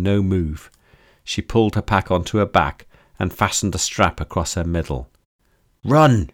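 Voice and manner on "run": sudden shout of "Run!". A narrator's reading. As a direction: yell it out in alarm. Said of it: "shouted Kara".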